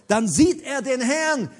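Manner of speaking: ordinary talk, nothing marked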